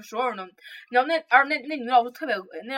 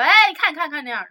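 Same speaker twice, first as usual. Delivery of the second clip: coy teacher; 6 semitones higher, 8 dB louder than usual. Retold or imitated. Teacher flirting in retold scene.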